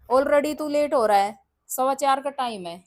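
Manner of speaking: loud, high, urgent nagging; scolding mother. Real, not acted. Mother loudly telling son he is late. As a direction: make him feel the lateness.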